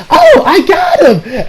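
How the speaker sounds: high, slow, laughing cheer of triumph; excited cheer. Retold or imitated. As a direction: squeal it like a surprised little win. Kale lands a punch.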